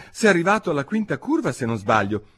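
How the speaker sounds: quick, high start settling down; polite, humble little correction. A narrator's reading. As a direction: offer it meekly, in a hurry. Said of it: "disse Alice con ogni umiltà".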